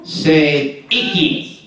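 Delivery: slow, clear iki as EE-kee; pronouncing iki. Shown as a demonstration. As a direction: say iki slowly. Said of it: "number two".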